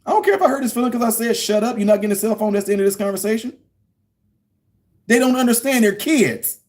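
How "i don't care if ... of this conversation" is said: high, fast brush-off; mock-defiant dad shutting it down; dismissive retort. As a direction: toss it away, done with the talk. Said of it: "I'm like".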